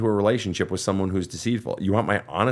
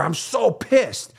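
mock fury; 9 semitones higher, much slower than usual.

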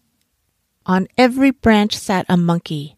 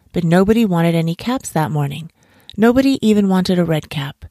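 usual speaking voice

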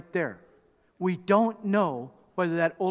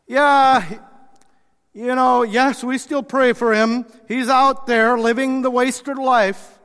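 loud, higher, slow and weary; resigned dismissal; 7 semitones higher, 10 dB louder, slower than usual. Acted. Father on the wasted son, loud weary dismissal. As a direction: sigh him off as a lost cause.

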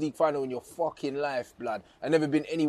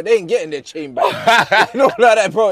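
laughing; 3 semitones higher, 10 dB louder than usual. Real, not acted. Gloating, laughing at Arsenal fans.

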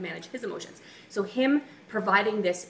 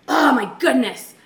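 louder, slow exasperated groan; frustrated exclamation; 5 semitones higher, 9 dB louder, slower than usual. Retold or imitated. Wife frustrated at the oven on Thanksgiving, loud slow exclamation. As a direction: let the frustration out in a drawn-out sigh. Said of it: "And I was like".

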